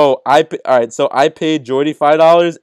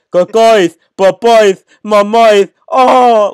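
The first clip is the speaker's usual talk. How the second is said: raised, slowed mock chant of nonsense; mock scream; 6 semitones higher, 7 dB louder, much slower than usual. Friends dictating the dare, mock screaming. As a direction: yell the nonsense words out slow. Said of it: "You just gotta crawl across half of the cafeteria and scream".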